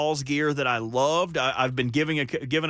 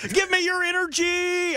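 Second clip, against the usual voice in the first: spirit bomb style; 12 semitones higher than usual. Acted. Fan rallying energy for the team, high spirit-bomb cry. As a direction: summon power with a sustained high yell. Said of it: "spirit bomb style".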